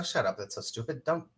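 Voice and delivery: soft, low retort to yourself; retold self-scolding, kept under the breath; muttered inner rebuke. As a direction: brush the thought off quietly. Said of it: "I go".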